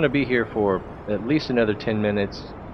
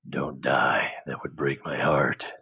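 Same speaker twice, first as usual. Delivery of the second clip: whispered plea; 6 semitones lower, slower than usual. Real, not acted. Pleading with the rocket.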